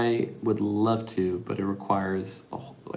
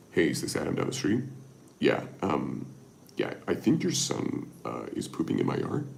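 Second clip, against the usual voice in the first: lower, put-on prank-call voice; disguised neighbor voice; 7 semitones lower, 5 dB quieter than usual. On a prank call to buddy Adam, a lower disguised voice. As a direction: fake a stranger on the phone. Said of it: "and put him on a voice".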